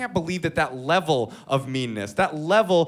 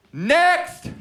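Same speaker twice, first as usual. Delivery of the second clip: sharp yelled heckle, rising high; heckler's shout; 9 semitones higher than usual. Retold or imitated. Heckler in the crowd screaming at the comic, a high yell. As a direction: shout it from the back of the room. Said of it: "screamed out".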